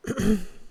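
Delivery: small, quiet throat clear. A narrator's reading. Heroine giving up on speaking, quiet throat clearing. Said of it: "clearing my throat".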